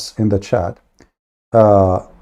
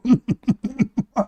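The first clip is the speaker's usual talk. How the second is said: mocking laugh; 10 semitones higher, 6 dB quieter than usual. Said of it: "they say to you".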